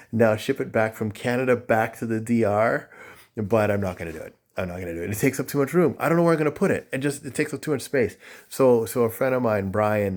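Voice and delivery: ordinary delivery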